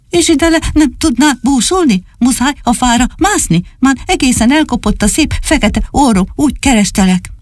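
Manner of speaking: high, fussing puppy voice; little dog voice. Acted. Csupi finds Dani up in the tree, high relieved fussing.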